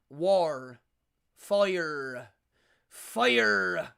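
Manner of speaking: WAR and FIRE with a loud, growled-out hard R; hard pirate R. Shown as a demonstration. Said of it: "Elizabethan had a hard R".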